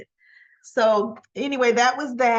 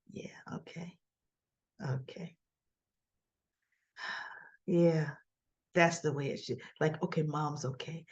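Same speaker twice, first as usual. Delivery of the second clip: breathy near-silent whisper, much softer, with a sigh; hushed whisper; 6 semitones lower, 10 dB quieter than usual. Retold or imitated. Boy watching his mother, whispering to himself with relief. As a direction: murmur it to yourself, barely voiced. Boy reacting quietly at table.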